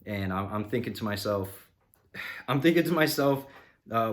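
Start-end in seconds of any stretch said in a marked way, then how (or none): 2.1-3.5 sighed, higher and louder, fed up; exasperated sigh. Retold or imitated. Annoyed customer sighing over the mechanic who vanished, exasperated. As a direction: let it out on a weary sigh.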